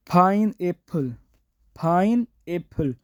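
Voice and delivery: पाइनएप्पल slowly with a puffed ph; careful slow demo of पाइनएप्पल; Korean pineapple. Shown as a demonstration. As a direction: stretch out पाइनएप्पल with aspirated ph. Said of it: "ये पी नहीं पढ़ेंगे".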